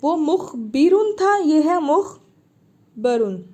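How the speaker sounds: मुख़बिरुन then मुख़बरुन drawn out slowly; slow contrast. Shown as a demonstration. Teacher slowly contrasting two word forms for students. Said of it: "अब देखें last वाला word देखें".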